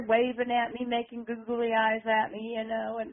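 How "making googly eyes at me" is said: plain reading voice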